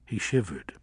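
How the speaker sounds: plain reading voice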